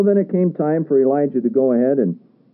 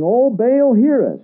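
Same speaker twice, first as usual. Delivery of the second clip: higher, louder pleading call; crying out to Baal; 9 semitones higher, 4 dB louder, slower than usual. A narrator's reading. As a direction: cry out like the prophets at noon. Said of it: "all the prophets of Baal cried".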